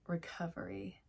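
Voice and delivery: breathy whispered word. Real, not acted. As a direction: drop to a whisper on recovery.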